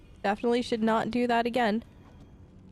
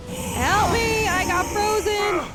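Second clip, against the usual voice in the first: loud, high yell for help; panicked cry for help; 10 semitones higher, 5 dB louder, slower than usual. Pretend. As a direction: call out to teammates mid-fight, high and loud. Player attacked in the game.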